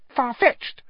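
high, squeaky aside; Pettigrew distracted mutter. A narrator's reading. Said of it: "Pettigrew was muttering distractedly".

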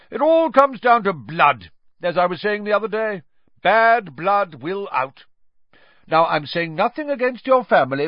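loud, high, blustering and scornful; booming high sneer; Aunt Marge, contemptuous. A narrator's reading. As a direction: lecture the table with drunken disdain. Marge insulting Harry's parents.

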